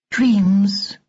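high, slow and musing; thoughtful doll voice. A narrator's reading. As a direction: ponder the word calmly. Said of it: "she said thoughtfully".

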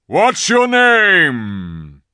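high, very loud, drawn-out bellow; roaring. A narrator's reading. Aslan asks the giant above his name, roaring. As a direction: roar the question upward, stretching it out. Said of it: "he roared".

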